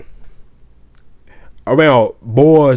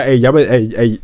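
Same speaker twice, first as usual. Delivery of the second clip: ordinary delivery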